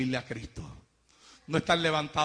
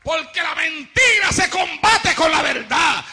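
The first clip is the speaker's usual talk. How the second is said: loud, hammering sermon; preaching at full force; 13 semitones higher, 11 dB louder than usual. Acted. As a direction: proclaim it to the whole hall.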